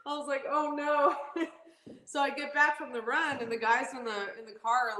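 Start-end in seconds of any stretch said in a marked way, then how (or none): 0.5-1.4 high "oh no" after a laugh; amused mock dismay. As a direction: retell dismay in a raised voice, amused. Racer retells dismayed reaction, laughing.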